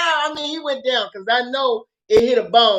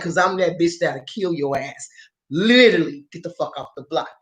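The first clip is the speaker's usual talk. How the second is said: retold low threat; 7 semitones lower than usual. Acted threat to kill.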